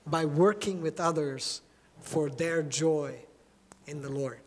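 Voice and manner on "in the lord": ordinary talk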